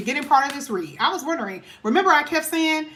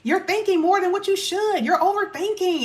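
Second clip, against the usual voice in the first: mock dismissive voice; 5 semitones higher than usual. Reader mocking a partner who calls you overreacting, condescending. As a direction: imitate someone brushing off your feelings.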